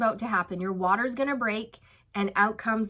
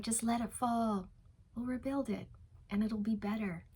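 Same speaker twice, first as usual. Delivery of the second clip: softer, easy, unhurried calm; soft calm reassurance; 8 dB quieter than usual. Pretend. The chill rooster soothing the panicking hens, softer and calm. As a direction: soothe the panickers as if nothing's wrong. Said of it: "You're like".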